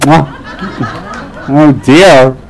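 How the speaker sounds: normal talking voice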